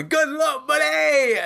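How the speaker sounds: high, mock-triumphant call; high-pitched jeering send-off; audible taunting call. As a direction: imitate the player calling out his taunt. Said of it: "you can hear it audibly going".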